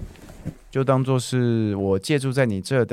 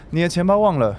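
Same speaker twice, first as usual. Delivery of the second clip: normal talking voice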